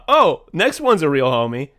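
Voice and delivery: ordinary delivery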